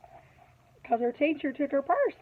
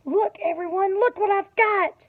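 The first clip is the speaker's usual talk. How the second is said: excited showing off; 6 semitones higher than usual. Acted. Lily shows off her purse.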